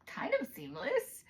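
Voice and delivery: high, slow, doubtful "kind of"; wry hedge. Retold or imitated. To viewers, on being asked about seamless: high, slow, doubtful hedge. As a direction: draw it out, unsure. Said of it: "And I was like".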